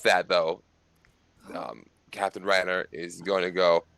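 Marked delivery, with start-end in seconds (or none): none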